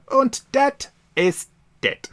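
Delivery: high, slow mock German accent. Podcaster voicing Stein's closing line in a German accent. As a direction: stretch it out in a comic German accent.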